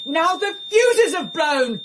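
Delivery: high, slow, fed-up cry; exasperated outburst. Acted. Wife fed up after the fuses blow, exasperated. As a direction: throw up your hands at it.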